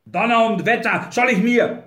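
loud, high-pitched cursing; outraged protest, cut short; angry outburst. A narrator's reading. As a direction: explode in indignation, pitched well up. Grinley curses, is cut off.

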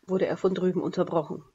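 plain reading voice

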